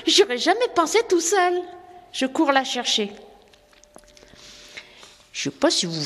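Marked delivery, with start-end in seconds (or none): 0.1-3.1 put-on much higher, excited young chimney sweep; eager young sweep. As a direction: answer the children with bright eager surprise. François talks to children.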